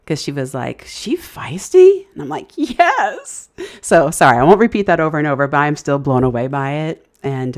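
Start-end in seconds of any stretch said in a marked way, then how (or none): 0.9-2.1 very high, drawn-out, louder question; re-enacted astonished query; retold incredulous question. Re-enacts stranger's surprised question. 2.6-3.5 high, stretched-out yes; re-enacted thrilled confirmation; retold emphatic agreement. Re-enacts her amazed agreement.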